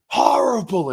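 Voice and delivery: high, loud retold outburst; exclaimed reaction. Seeing his kid crying, exclaimed reaction. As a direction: act out a sudden loud exclamation.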